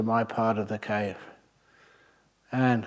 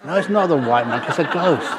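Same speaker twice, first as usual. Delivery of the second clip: louder, higher mock protest; retold arguing villager; 4 semitones higher, 5 dB louder than usual. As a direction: imitate a villager excitedly contradicting a friend. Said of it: "one of them said".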